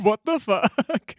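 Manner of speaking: slow, drawn-out shocked disbelief; stunned disbelief. Retold or imitated. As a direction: drag it out in disbelief. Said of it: "I was like".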